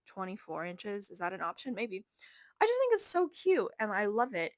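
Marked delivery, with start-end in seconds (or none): 2.6-4.5 much higher and louder, gushing. Real, not acted. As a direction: squeal it with delight. Gushing about her TV.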